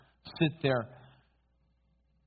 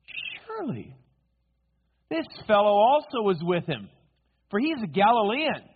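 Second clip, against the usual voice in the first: high, insistent finger-pointing; confident accusation; 6 semitones higher, 5 dB louder than usual. Acted. Another bystander identifies Peter as a Galilean, confidently accusing. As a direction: affirm it with raised-voice certainty. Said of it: "another confidently affirmed".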